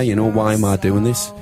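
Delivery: ordinary talk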